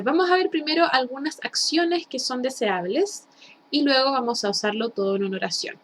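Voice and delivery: normal talking voice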